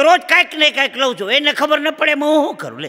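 wife defending herself with gusto; indignant wife. Acted. Wife to husband who scolded her, loudly indignant.

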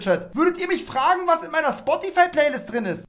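high, loud, exasperated ranting at chat; incredulous rant. Real, not acted. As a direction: rant to chat about a boring question. Streamer ranting at chat about question. (lauter, hoher empörter Rant)

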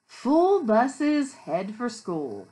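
loud, slow storybook reading; read-aloud to children. A narrator's reading. Teacher reads the bus book to children, slow read-aloud.

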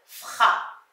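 ordinary talk

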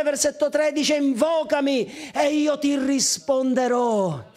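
ringing scripture proclamation; preaching at full force. Acted. Pastor to those online in trouble, proclaiming. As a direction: lift the words up to the hall.

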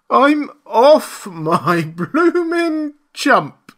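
high, loud, long-drawn wail; despairing wail. A narrator's reading. Marvel shaken by the collar, despairing wail. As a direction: wail it out word by word, pitched high. Marvel fears he has gone mad.

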